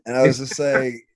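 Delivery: ordinary talk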